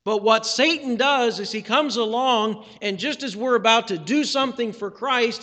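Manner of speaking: ordinary talk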